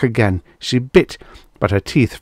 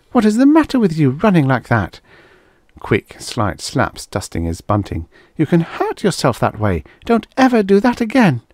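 scolding; 8 semitones higher than usual. A narrator's reading. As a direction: tell the boy off after a fright. Mother scolding child.

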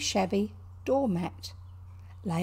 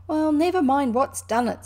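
loud, high, pained protest; high, loud and hurting; pained impatience; 6 semitones higher, 8 dB louder than usual. A narrator's reading. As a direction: cry it out, too sore to care. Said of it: "forgetting his grammar in his pain".

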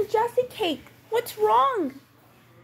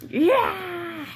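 ordinary talk

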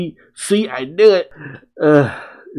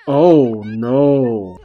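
ordinary talk

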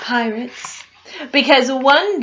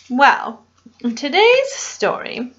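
plain reading voice

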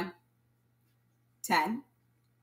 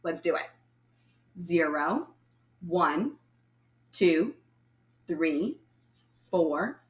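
usual speaking voice

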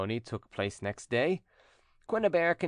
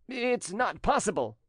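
high-pitched, slow cry of disbelief; disbelieving exclamation; 10 semitones higher than usual. A narrator's reading. Jilted cousin refusing the wedding news, incredulous. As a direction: exclaim it, unable to believe it. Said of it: "exclaimed Trumeau".

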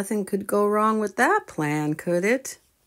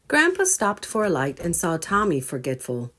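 plain reading voice

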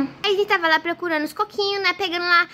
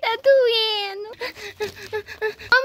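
drawn-out tearful wail; acted crying; much slower than usual. Hurt boy left waiting for mother, crying. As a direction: sob through the hurt. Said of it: "ele tá lá chorando".

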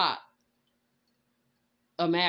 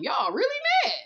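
mocking disbelief; 3 dB louder, faster than usual. Real, not acted. Mocking the angry people.

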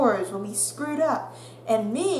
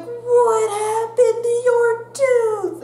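put-on high, slow singsong kid voice; curious classmates; 13 semitones higher, 7 dB louder, slower than usual. Classmates asking about the broken tooth, singsong. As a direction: play the nosy classmates in a lilting voice. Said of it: "everyone was like".

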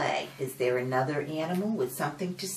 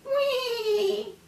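high excited squeal; 5 dB louder than usual. Acted. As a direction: squeal with delight.

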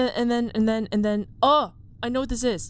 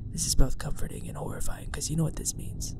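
hushed whisper; 2 semitones lower than usual. Real, not acted. Whispering to viewers while hiding from the monster. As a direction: whisper it all, breathy and hushed.

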